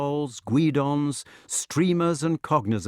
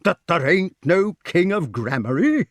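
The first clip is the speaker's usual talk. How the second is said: loud, raised outraged question; indignant; 7 semitones higher, 6 dB louder than usual. A narrator's reading. Sir Grummore questions the lack of a king, indignantly. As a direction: climb higher in affronted disbelief. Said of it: "exclaimed Sir Grummore indignantly".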